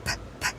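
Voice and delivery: bare "p, p" puffs without voice; soft voiceless P bursts; plosive P. Shown as a demonstration. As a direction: release only the p consonant. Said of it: "You see the sound?".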